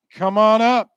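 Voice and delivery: loud summons from the stage; calling out. Real, not acted. Host calling the Miller family up, loud. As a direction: project it, inviting them up. Calls winner family onstage.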